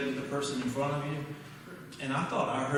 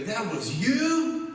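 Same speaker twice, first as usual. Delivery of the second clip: high, loud, drawn-out astonishment; amazed disbelief; 8 semitones higher, 5 dB louder, much slower than usual. Retold or imitated. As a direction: stretch it out, imitating stunned coaches. Coaches acted out in astonishment.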